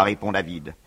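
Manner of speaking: ordinary delivery, nothing marked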